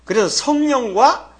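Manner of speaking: ordinary talk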